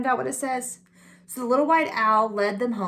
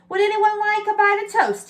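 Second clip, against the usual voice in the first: a hint of a small owl, higher; hopeful little owl; 10 semitones higher, 4 dB louder than usual. A narrator's reading. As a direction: offer it brightly like a little owl. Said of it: "he said".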